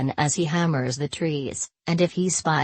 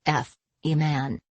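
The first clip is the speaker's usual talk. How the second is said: ordinary delivery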